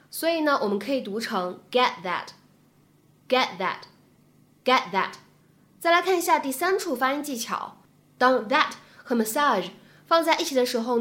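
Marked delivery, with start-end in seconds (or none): 1.7-5.1 "get that" slowly, the t of "get" held and not released; incomplete plosion. Shown as a demonstration. Teacher slowly drilling "get that" for students, t unreleased. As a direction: repeat "get that" slowly, swallowing the t before "that". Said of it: "可以有一个不完全爆破的处理".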